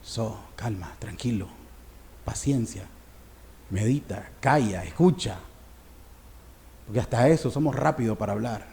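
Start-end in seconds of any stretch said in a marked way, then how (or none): 0.0-5.4 much softer, low and hushed; soothing calm. Acted. The Spirit calming an angry believer, soft and hushed. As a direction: hush a restless soul gently. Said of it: "pero el Espíritu que le dice".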